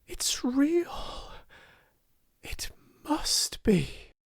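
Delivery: high, whispery, overcome; almost sobbing. A narrator's reading. Girl holding the gown to her cheek, almost sobbing. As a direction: breathe it out high, near tears. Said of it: "she almost sobbed".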